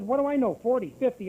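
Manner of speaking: high, exasperated comeback; retold exasperation. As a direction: throw out wild guesses, playing up the exasperation. Retold flustered answer to doctor.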